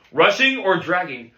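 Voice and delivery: forceful bandleader's question; angry demand. Acted. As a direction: press the student hard for an answer. Teacher demanding an answer.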